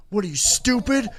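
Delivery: high, loud taunting cop impression; mocking police chief. Teller imitates the tall cop taunting them, high and loud. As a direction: sneer it like a fed-up cop. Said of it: "I remember him going".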